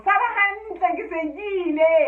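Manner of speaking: hasty goodbye. Acted. Fox tells rooster goodbye as the dogs come, loud hurried farewell. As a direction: bid goodbye while already fleeing.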